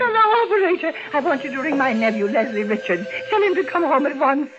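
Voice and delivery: high, rushed, desperate urging; panicked pleading. Acted. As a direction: beg the operator to hurry. Panicked call to operator.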